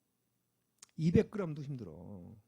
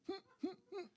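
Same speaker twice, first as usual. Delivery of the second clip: straining grunts; 8 dB quieter than usual. Retold or imitated. Trainer lifting sixty kilos.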